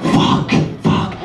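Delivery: whispered curses while sneaking past; mock whisper. Passers-by creeping past zombies, whispered mock panic. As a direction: hiss it under your breath, creeping by. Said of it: "trying to creep by like".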